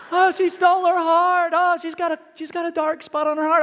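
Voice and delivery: high, loud gushing of a hooked viewer; mock distress. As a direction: wail it like a fan far too involved. Mimics himself hooked on TV show.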